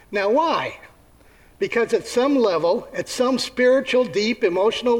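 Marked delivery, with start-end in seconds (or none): none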